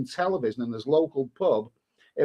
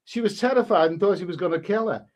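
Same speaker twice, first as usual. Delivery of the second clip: usual speaking voice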